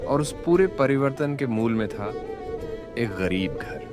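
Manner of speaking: ordinary delivery, nothing marked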